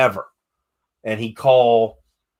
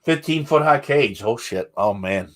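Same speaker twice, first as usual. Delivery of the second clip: ordinary talk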